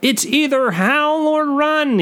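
high, slow panicky Todd; insisting Todd. A narrator's reading. Todd insists to his friend in a high, panicky voice. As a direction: plead it in a high nervous voice. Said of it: "he insists".

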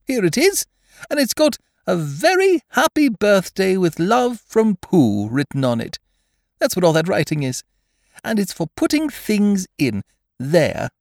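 high, eager bear explaining his gift; eager Pooh. A narrator's reading. Pooh explaining the pot to Eeyore, eager. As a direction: show it off in a high voice.

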